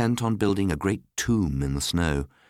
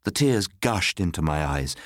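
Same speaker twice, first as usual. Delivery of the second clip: usual speaking voice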